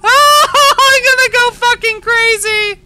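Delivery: loud, high, drawn-out wail; frantic, exasperated howl; unhinged yell. Real, not acted. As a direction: scream it like you're losing it. Losing it at game.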